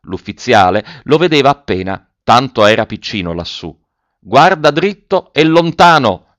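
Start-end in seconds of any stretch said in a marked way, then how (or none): 4.2-6.4 high, drawn-out loud call; shouted order. A narrator's reading. Officer to the boy up in the tree, shouting. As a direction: call slowly to someone far above. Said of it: "gridò l'ufficiale".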